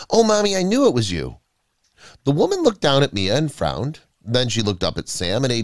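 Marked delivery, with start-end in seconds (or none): 0.1-1.4 put-on high, gushing little girl; excited child, pitched well up; child's happy cry. A narrator's reading. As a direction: pour out relief to a found mother. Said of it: "she cried".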